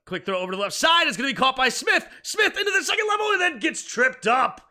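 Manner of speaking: loud, high, thrilled sports call; excited play-by-play. Acted. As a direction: shout the big play like a broadcaster.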